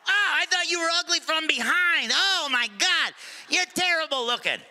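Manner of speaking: high, loud squawking parrot. Acted. Parrot insulting the customer in a high, loud squawk. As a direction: screech the insults like a delighted bird.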